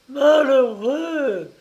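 high, loud, drawn-out cry of horror; dying mother horrified by her son's crime. Acted. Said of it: "s'écria la mourante au comble de l'effroi".

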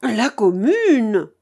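loud, drawn-out shout; cri impatient. A narrator's reading. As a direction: call it out slowly as an impatient crowd. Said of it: "cria l'assemblée impatiente". (cri traînant d'assemblée impatiente)

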